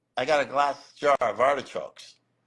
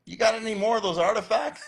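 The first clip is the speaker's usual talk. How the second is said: higher, laughing through the friend's question; laughing quote; 9 semitones higher, 4 dB louder than usual. Retold or imitated. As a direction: ask eagerly while cracking up. Friend asks for more.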